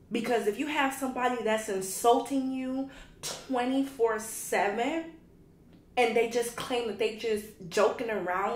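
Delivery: loud, heated rant at a hater; loud angry ranting at a hater. Real, not acted. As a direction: let the fury build as you call him out.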